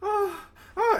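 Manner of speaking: high breathy-free mock moans; movie moaning imitated. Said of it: "she goes".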